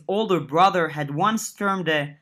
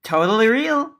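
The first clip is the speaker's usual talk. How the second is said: half-smiling tease; 6 semitones higher, 5 dB louder than usual. Acted. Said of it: "a half smile on her face".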